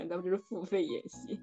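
ordinary talk, nothing marked

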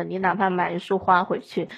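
normal talking voice

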